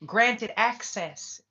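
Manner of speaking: granted access drawn out slowly, each word leaned on hard; slow stress on granted access. Real, not acted. Telling viewers forgiveness isn't granted access, slow and stressed. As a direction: stretch out granted access and lean on it. Hammering the point about forgiveness.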